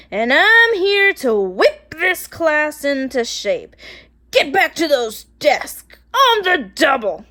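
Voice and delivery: a strict teacher whipping the class into shape; stern witchy teacher. Acted. Miss Swamp ordering the kids back to their desks, loud and stern.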